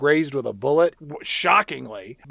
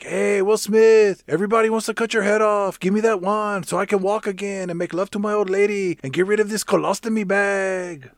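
mock villain calling out in a raised voice; Poison; 4 semitones higher than usual. Villain in a wheelchair demanding the wand, hammed-up taunting. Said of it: "he rolls in, in his wheelchair, and he's like".

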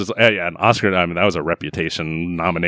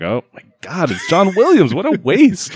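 mock amazement; 9 semitones higher than usual. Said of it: "and I was like".

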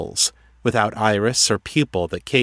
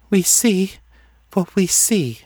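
high, detached oracle voice; distant; 11 semitones higher than usual. A narrator's reading. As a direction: pitch it high and far away, as if dreaming.

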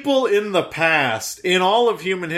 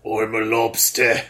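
lower, lightly put-on British tough guy; a hint of a gruff British action star; Statham impression demo; 6 semitones lower than usual. Shown as a demonstration. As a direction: show how to do Statham. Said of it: "you wanted to hear it, how to do it?".

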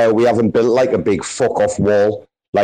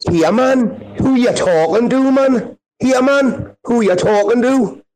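much higher, challenging Geordie accent; 11 semitones higher than usual. Shown as a demonstration. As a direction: lay on a high, cocky Geordie for the group. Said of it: "like, this Geordie accent for me".

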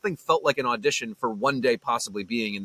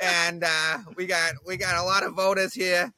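put-on higher-pitched Boston mayor with a thick local accent; Tom Menino; 8 semitones higher, slower than usual. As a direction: imitate a Boston politician at the podium. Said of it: "clips of Tom Menino".

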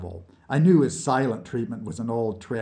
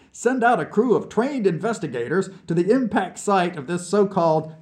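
higher, eager Vlad pitching his big idea; excited visionary pitching; 9 semitones higher, 5 dB louder than usual. Acted. Vlad recounting his bold proposal to the chief, excited and higher. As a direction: press the idea with animated, swooping pitch.

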